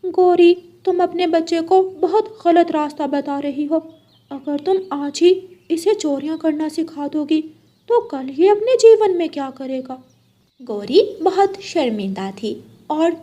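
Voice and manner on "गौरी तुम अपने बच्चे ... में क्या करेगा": higher, louder scolding voice; angry rebuke. Acted. Parrot uncle rebukes Gauri for teaching her son to steal, higher and louder. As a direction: tell her off for teaching theft.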